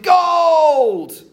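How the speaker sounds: long drawn-out high shout; joyful wish shout. Acted. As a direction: shout it all the way down the slide. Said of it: "went down the slide and shouted".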